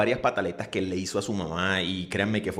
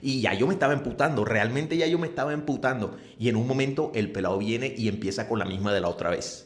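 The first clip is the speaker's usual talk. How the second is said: ordinary talk, nothing marked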